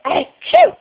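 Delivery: loud, high-pitched "achoo!"; fake sneeze. Shown as a demonstration. Mother showing toddler a sneeze, playful fake sneeze. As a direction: do a big pretend sneeze. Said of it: "You wanna go".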